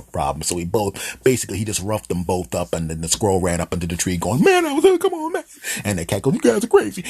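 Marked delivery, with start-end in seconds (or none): 4.4-5.4 extremely high, loud squeal; protesting squirrel. Acted. Roughed-up squirrel fleeing up a tree, squealing in protest. 6.3-7.0 very high, loud cartoon squeal; fleeing cat. Roughed-up cat running away from man and dog, high squeal.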